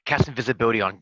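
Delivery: urgent call. Real, not acted.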